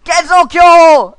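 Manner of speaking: shouted correction; loud correction. Real, not acted. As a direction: shout the name out. Correcting the name.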